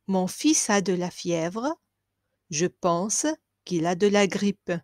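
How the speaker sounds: ordinary talk, nothing marked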